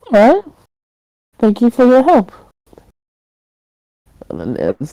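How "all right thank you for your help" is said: Elton's reply. Acted. Game master voices Elton's reply.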